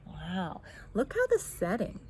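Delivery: usual speaking voice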